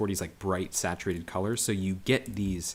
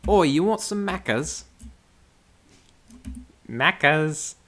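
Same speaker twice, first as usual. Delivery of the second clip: put-on much higher and louder, broad Aussie accent; hammed-up Australian accent; 10 semitones higher, 9 dB louder, slower than usual. Offering McDonald's the Australian way, in a broad mock Australian accent. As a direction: offer it like a cheery Aussie at the counter. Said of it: "my Australian accent".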